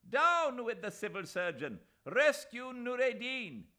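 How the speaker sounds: high, loud protest yell; rally the crowd; yelling slogans. A narrator's reading. Mahmud Ali shouting slogans to the crowd, loud yell.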